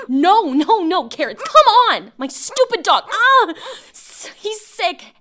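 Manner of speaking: sudden alarm as the dog gets sick. Acted.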